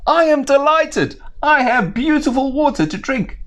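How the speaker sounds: a bird overjoyed at its water; delighted crow. Acted.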